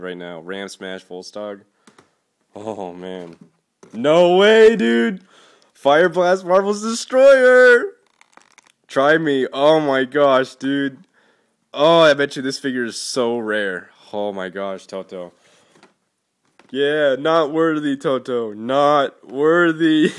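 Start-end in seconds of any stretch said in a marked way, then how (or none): 3.9-12.1 very high, very loud, slow joyful yelling; ecstatic. Real, not acted. Finding the figure he wanted most, ecstatic slow yelling. 16.7-20.1 slow, loud, high chant of mock homage; bow down playfully, drawn out; mock not worthy. Honouring his generous friend Toto, hammed-up loud slow homage.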